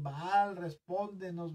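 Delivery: mock cry of worshippers pleading for an answer; imitated crying out. Prophets of Baal clamoring to their god, imitated shouted plea. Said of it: "clamaban y gritaban y se cortaban y decían".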